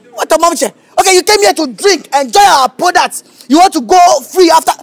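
loud, high mocking scold; scolding rant. Manager scolding the customer who can't pay. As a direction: berate him, loud and scornful.

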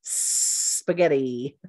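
Spaghetti drawn out slowly, S held before the p; slow S-blend demo. Shown as a demonstration. Teacher showing learners the S blend. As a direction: start Spaghetti with a long S, no vowel. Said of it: "Show me your teeth".

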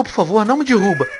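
normal talking voice

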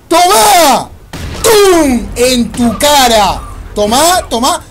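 loud, punchy battle shouts; hyped shouting. Real, not acted. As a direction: shout each hit at the enemy.

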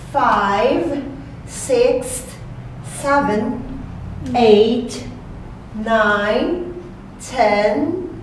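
put-on slow, sing-song counting for kids; slow counting demo. Shown as a demonstration. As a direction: count each number slowly with a rise. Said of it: "Okay, let's start".

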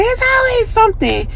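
high, whiny, fed-up complaint; exasperated whine. Retold or imitated. Answering her husband on the phone, whiny and fed up. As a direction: play it as a put-upon sigh of defeat. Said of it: "I was just like".

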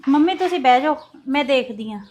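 calling out. Acted. Daughter-in-law calling out loudly to the visitor at the door. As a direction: call out to whoever has come.

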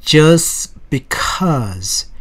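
"Just because" with the t of "Just" dropped, said "jus because"; "Just" with no final t, blended into "because". Shown as a demonstration. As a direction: swallow the t in "Just" so it runs straight into "because". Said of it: "T가 단어 끝에 나오면 과감히 날려버립니다".

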